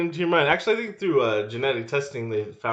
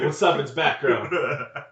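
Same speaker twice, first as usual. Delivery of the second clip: put-on cocky vampire girl; 4 semitones higher, 6 dB louder, slower than usual.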